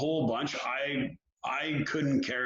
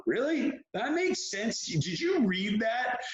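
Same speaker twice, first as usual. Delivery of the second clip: high-pitched, incredulous challenge; acted-out disbelief, pitched well up and drawn out at the end; mock incredulous; 7 semitones higher, slower than usual. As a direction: question it in exasperated disbelief. Acts out disbelief at muddled wording.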